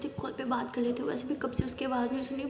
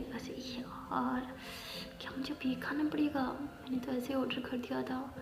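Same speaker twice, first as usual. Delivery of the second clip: whispered grumble; 10 dB quieter, slower than usual. Acted. Grumbling to herself about food.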